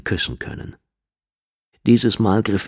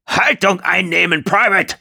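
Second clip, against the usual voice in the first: drill sergeant shout; 13 semitones higher than usual. Acted. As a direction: bark it across the barracks. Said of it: "Die Worte waren so laut".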